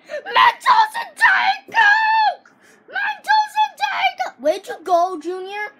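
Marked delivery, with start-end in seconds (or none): 0.1-2.5 very high, slow, stretched-out scream; excited yell. Acted. Kid screams his product name with delight, very high. 2.9-4.4 high, quicker repeated scream; excited yell. Acted. Kid screams his product name again, high.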